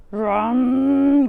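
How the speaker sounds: bear growl. Acted. Old Bear growling over the nuts. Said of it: "growled Old Bear".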